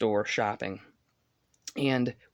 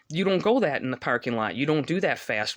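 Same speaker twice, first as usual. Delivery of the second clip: ordinary talk, nothing marked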